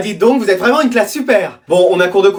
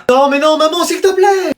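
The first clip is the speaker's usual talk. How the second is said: pleading whine; 6 semitones higher than usual. Acted. Mother and son quarrel.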